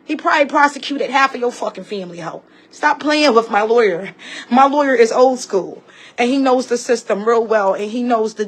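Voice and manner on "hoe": ordinary talk, nothing marked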